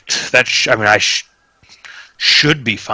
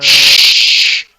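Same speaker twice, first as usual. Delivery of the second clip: creepy shush; 4 semitones higher, 7 dB louder than usual. Acted. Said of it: "Man, that, that's creepy".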